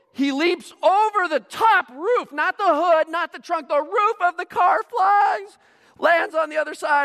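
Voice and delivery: loud, high, amazed storytelling; hammed-up awe at a stunt; hyped stunt retelling. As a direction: build the leap into a huge spectacle. Hyped retelling of the car leap.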